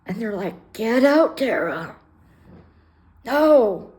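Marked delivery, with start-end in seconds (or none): 0.7-2.0 loud retold command to leave; yelled order. Staff ordering Tara out of the shelter, loud. 3.2-3.9 short loud no; flat refusal, raised voice. Retold or imitated. Tara refusing the staff's order, loud no.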